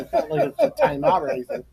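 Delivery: high, fast laughing aside. Real, not acted. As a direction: laugh through it. Laughing at the memory.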